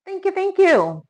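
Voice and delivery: high bright thank-you to the chat; delighted high thanks to viewers; excited thanks. Real, not acted. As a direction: squeal a grateful thank you.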